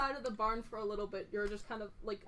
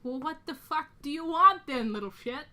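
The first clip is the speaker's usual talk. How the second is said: loud, raised-pitch angry demand; furious; 3 semitones higher, 6 dB louder than usual. Acted. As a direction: confront them, loud and exasperated. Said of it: "absolutely furious".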